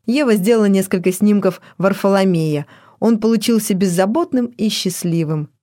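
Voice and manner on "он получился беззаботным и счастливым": plain reading voice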